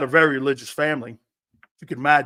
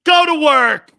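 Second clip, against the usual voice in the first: loud shout; 9 semitones higher, 13 dB louder, slower than usual. Real, not acted. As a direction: bellow it out as a rallying cry. Repeated pep shout.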